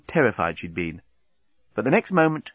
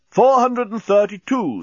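high, loud, slow countdown; counting bomb; 10 semitones higher, 5 dB louder than usual. A narrator's reading. Bomb counting on regardless, slow and loud. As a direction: call out the count deliberately.

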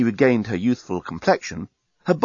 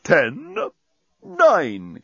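talking bomb; 7 semitones higher than usual. Acted. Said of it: "counted the bomb".

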